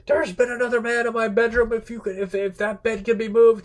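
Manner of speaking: high, loud mock outrage; acted jealous outburst. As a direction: play the indignant husband, voice pushed way up. Acts out Odysseus's jealous outburst.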